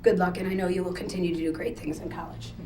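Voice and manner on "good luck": ordinary talk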